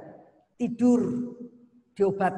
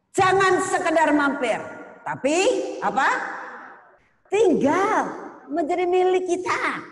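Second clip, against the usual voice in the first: high, loud call to the congregation; fiery preaching; 9 semitones higher, 5 dB louder than usual. Acted. Preacher urges the congregation not to just stop by, loud and fiery. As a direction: preach it out with full force, rising to TINGGAL.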